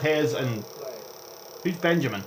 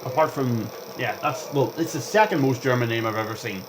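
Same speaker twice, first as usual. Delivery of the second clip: normal talking voice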